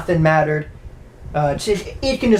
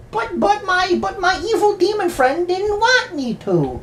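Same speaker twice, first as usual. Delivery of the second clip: put-on high, sweet little-girl voice; creepy little girl; 13 semitones higher than usual. Girl blames her evil demon friend, high childish voice. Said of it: "she's like".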